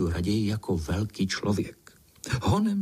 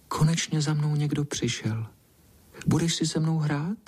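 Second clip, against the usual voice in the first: higher, shy cat voice; soft, timid little voice; 7 semitones higher than usual. A narrator's reading. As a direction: ask shyly in a small high voice. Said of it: "tím hebkým hláskem plaše povídá". (plachý hebký hlásek)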